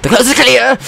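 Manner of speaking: loud, high cry of relief; triumphant shout. Real, not acted. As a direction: yell it out high and glad.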